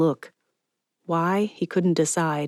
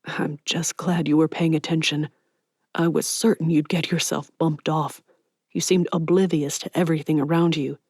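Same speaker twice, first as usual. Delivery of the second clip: usual speaking voice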